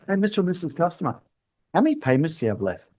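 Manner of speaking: quiet and quick, casual question; soft, friendly ask. Shown as a demonstration. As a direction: ease the question out softly, quickly. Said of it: "Why don't we just look".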